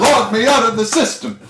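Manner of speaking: high put-on echo; bar patron. Patron echoes the bard's song title, high-pitched. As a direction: call it back in a high, silly voice.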